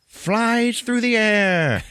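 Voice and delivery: very high, drawn-out narration; soaring exaggeration. Real, not acted. Podcaster telling of Liz thrown, high and drawn out. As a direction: stretch it high as if sailing through air.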